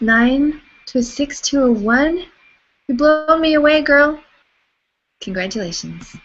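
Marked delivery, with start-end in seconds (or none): 0.0-4.2 rising, loud, thrilled praise; excited. Real, not acted. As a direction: cheer her on, delighted. Celebrating a viewer's big drop.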